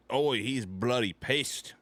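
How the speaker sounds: slow, drawn-out Aussie that comes out British; badly faked Australian, sliding toward British; attempted Australian accent. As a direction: stretch it out in a put-on Australian accent. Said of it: "I sound more British when I do it than I do Australian".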